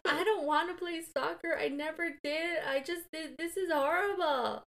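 high, loud, choked-up wail, slowing at the end; upset crying. Retold or imitated. Kid bursting into tears to mom about soccer, high and loud. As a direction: replay the kid breaking down in tears.

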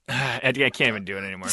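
usual speaking voice

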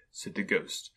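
ordinary delivery

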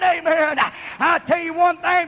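ordinary delivery

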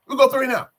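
high, loud exasperated repeat; fed-up fan, voice jumping up; frustrated outburst. Real, not acted. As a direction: cry it out in sheer frustration. Repeats the failed drive in frustration.

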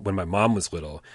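ordinary talk, nothing marked

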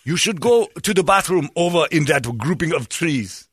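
high, sly caricature voice; mock villager voice. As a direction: lure him with fake friendliness. Voicing villagers tricking Fieri.

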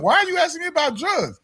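high-pitched retold challenge; indignant disbelief. Driver challenging officer about drugs, indignant. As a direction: question the cop in baffled protest.

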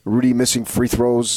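usual speaking voice

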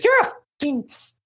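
high put-on voice tossing out a crude insult. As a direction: mimic a tipsy cast mate swearing across the table. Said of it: "and she's like".

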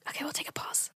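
soft whisper. Real, not acted. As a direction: whisper it aside. Partner cramping, pausing recording.

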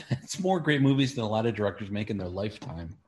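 ordinary talk

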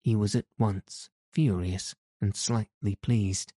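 plain reading voice

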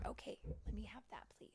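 ordinary delivery, nothing marked

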